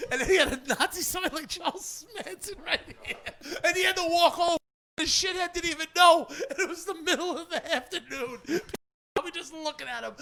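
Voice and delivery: high-pitched talking through laughter; cracking up. Real, not acted. Comedian telling friends about his prank, cracking up. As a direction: barely get the story out for laughing.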